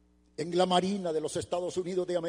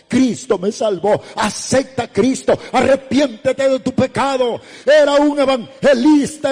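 full-force preaching; 6 semitones higher, 15 dB louder than usual. Retold or imitated. Evangelist urging stopped drivers to repent, rising to full-force preaching. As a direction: swell into loud, stretched-out exhortation.

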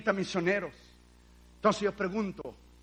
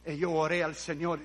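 normal talking voice

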